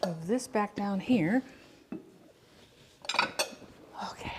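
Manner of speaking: soft whispered okay. Real, not acted. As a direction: breathe the word out. Whispered aside.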